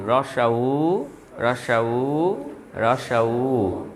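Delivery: রসা উ with the final উ stretched out slowly; long U drilled. Shown as a demonstration. Teacher drilling the U sound, slow drawn-out রসা উ. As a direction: hold the উ at the end of রসা উ. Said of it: "ইউ কি হবে?".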